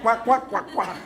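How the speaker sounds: duck quack. Pretend. Es la canción del pato.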